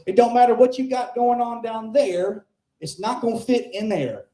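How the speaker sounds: put-on high, twangy old barfly voice; aunt needling the comic, high and mocking. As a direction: lean in like a leering old aunt. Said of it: "She says".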